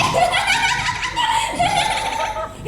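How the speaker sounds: high, loud trilling laugh. Acted. Woman laughing after telling how the thieves fled, high loud cackle. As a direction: burst into a long high cackle.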